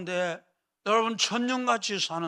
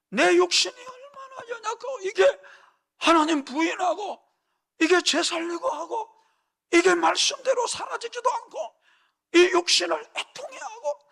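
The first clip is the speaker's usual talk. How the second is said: preaching at full force; 11 semitones higher than usual. Acted. Preacher urging the congregation that the church weeps daily, full-force preaching. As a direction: pour it out like a revival preacher.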